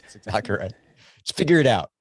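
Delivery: sarcastic fake encouragement. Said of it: "we're being sarcastic".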